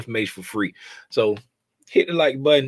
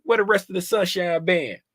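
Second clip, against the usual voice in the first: usual speaking voice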